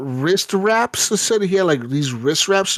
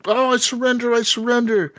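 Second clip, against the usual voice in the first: mock pleading surrender; 8 semitones higher than usual. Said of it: "his buddy was like".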